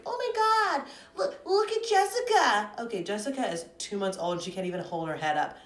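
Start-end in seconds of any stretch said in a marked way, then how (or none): 0.0-2.7 very high squealing mock parent; gushing parent.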